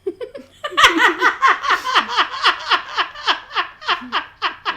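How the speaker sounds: long, high, loud cackle; high staccato laughing fit; helpless laughter. Real, not acted. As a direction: laugh in a long rhythmic run. Laughing at the tomato joke.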